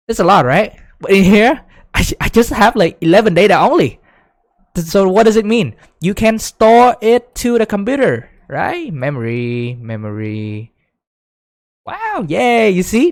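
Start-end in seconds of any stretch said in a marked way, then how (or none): 0.1-8.9 high and louder, thrilled to show the point; excited. Real, not acted. Teacher thrilled that eleven data fit in memory, excited. 11.9-13.1 high, loud little cheer. Real, not acted. Teacher cheering as students see the trick, excited.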